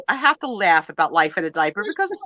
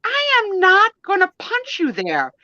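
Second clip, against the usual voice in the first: "I am not gonna punch you there." higher and slower; husband refusing; 10 semitones higher, 3 dB louder than usual. Retold or imitated. Husband refusing his wife's request, slow firm refusal. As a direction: refuse firmly as the husband, stretched out. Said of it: "He's like".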